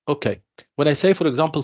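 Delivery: usual speaking voice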